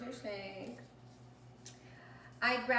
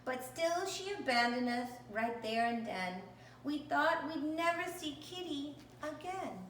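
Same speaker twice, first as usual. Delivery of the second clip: ordinary delivery, nothing marked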